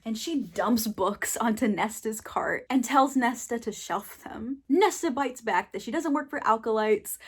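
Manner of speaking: usual speaking voice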